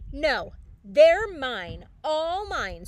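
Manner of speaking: loud, slow possessive yell; grouchy scream. A narrator's reading. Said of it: "screamed the grouchy ladybug".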